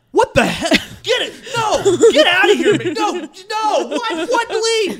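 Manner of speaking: high, loud retold outburst; outraged shouting. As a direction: imitate a man yelling someone out, high and loud. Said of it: "caused a scene".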